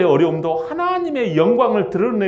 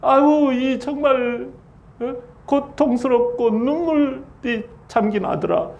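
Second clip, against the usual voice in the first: mock mourning cry over the son; lament; 6 semitones higher, slower than usual. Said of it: "이런 뜻이에요". (높고 큰 "아이고" 곡하는 흉내)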